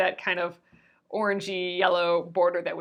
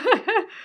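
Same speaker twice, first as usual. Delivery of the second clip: short laugh; 11 semitones higher than usual. Real, not acted. Laughs at her own joke.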